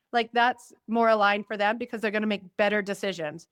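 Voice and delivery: normal talking voice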